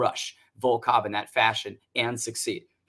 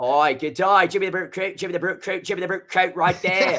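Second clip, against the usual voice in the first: hammy Australian accent, pitched up; 5 semitones higher, 3 dB louder than usual. Shown as a demonstration. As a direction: say it like a cheeky Aussie. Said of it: "in an Australian accent".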